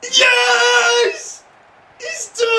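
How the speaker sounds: high screaming yes, pure relief; triumphant yell. Real, not acted. Gamer hearing the final whistle, triumphant scream.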